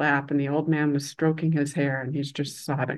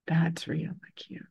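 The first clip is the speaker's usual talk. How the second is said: hushed, near-whispered awe; quiet realization; 6 dB quieter than usual. Retold or imitated. Young student watching the old man, hushed realization. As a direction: let it out softly as a dawning realization. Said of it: "just feels this wash of".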